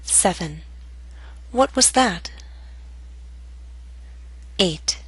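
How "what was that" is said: "What was that?" high, then falling on "that"; falling intonation. Shown as a demonstration. Teacher models question to repeat, falling intonation. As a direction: start high and drop on "that". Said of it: "practice intonation patterns and questions".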